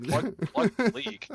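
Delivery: high, loud mock Turkish official; Turkish accent impression. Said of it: "That's my turkey impression".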